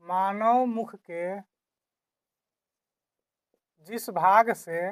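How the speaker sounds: slow, chunked repeat for note-taking; slow dictation. Shown as a demonstration. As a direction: dictate it slowly, pausing between phrases. (धीरे-धीरे, रुक-रुककर लिखवाते हुए)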